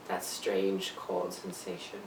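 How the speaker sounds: slow, very low and soft; chilled hush. Acted. Patient recalls the doctor's news, low and hushed. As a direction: sink low and slow, as if numb.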